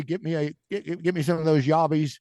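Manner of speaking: put-on Aussie accent, butchered for laughs; mock Australian. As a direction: fake Australian ordering at a barbecue. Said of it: "we're butchering your accent".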